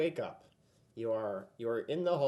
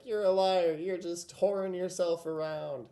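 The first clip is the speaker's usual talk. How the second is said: exasperated queen, higher and louder; raised, higher scolding voice; acted accusation; 6 semitones higher, 6 dB louder than usual. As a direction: hurl the accusation as a fed-up queen. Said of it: "Guinevere's like".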